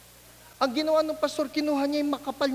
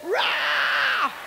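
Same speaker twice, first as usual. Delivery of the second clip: mock scream. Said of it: "sumigaw yung estudyante".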